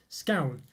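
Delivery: "Scown" with the ow vowel of town; scown pronunciation. Shown as a demonstration. Testing a viewer's way of saying it, scown pronunciation. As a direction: say "Scown" to rhyme with down. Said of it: "That's how you pronounce it".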